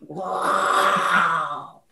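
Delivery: low, drawn-out bellow; bear roar. Acted. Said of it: "Bramiu ele".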